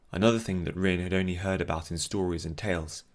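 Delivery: usual speaking voice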